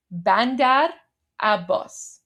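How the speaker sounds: "Bandar" rising then "Abbas" falling, like a native Persian speaker; correct local pronunciation. Shown as a demonstration. Teaching viewers the Iranian port name, said the proper Persian way. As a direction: say "Bandar" up and "Abbas" down with the stress on "bas". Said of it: "It's pronounced".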